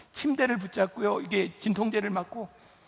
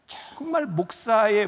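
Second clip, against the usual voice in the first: ordinary delivery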